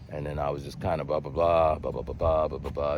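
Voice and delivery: low, soft mumbled monotone blahs; mock droning. Imitating someone skimming through their hard-luck story, mock droning.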